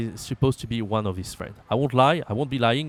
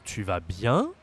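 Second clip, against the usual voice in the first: "tu vas bien" climbing on the last word; rising question tune; 3 semitones lower, slower than usual. Shown as a demonstration. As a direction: start low and raise "bien" into a question. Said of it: "raise your voice, of course".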